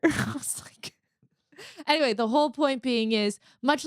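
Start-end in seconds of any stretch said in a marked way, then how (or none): 0.0-0.9 breathy laugh into the words; whispered laugh. Real, not acted. Laughing quietly while retelling the hotel story. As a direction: laugh it out under your breath.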